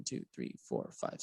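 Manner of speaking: counting under the breath; whispered counting. Real, not acted. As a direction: whisper the numbers to yourself.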